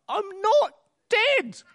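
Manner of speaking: put-on very high, slow old man's voice; comic old man protesting in a thin high pitch; old man calling out. Old man about to be thrown on the cart, calling out. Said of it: "the old man calls out".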